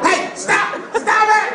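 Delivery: very high, loud panicked scream. Retold or imitated. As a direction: shriek at him to stop. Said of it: "started screaming".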